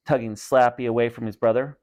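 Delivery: ordinary delivery, nothing marked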